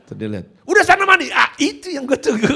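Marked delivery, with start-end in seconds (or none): 0.7-1.3 very high, loud barked order; snappish father. Retold or imitated. Father sends son to bathe, snappish high shout. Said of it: "jangan nyolot". 1.3-2.5 high, loud, laughing punchline; laughing delight. Acted. Son hears awaited order, laughing delight.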